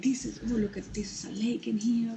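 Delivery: high, hushed frightened whisper; scared whisper. Retold or imitated. Woman at the gas station whispering her fear that the man will kill her. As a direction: whisper it like danger is close. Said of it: "I was like".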